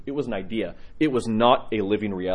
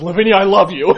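higher and louder, bursting out; loud declaration; 7 semitones higher, 10 dB louder than usual. Retold or imitated. Dying father to Lavinia, loud blurted love. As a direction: blurt it out joyfully. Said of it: "he goes".

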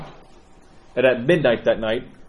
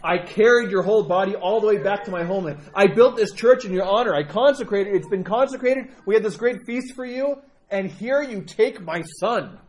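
rising, higher, more desperate lament; mother reproaching the saint for her son's death; 6 semitones higher than usual. Retold or imitated. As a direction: pour out the grievance, climbing in pitch. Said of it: "she begins lamenting like crazy".

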